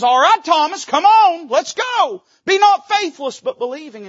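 high, loud, bold call to come; rally him forward, voice lifted high; Jesus calling Thomas. Acted. Jesus to doubting Thomas, a loud high beckoning call. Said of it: "Jesus shows up and says".

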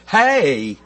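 "hey" drawn out into two syllables, North Carolina style. Shown as a demonstration. Preacher jokes about home speech, North Carolina drawn-out "hey". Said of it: "two syllables".

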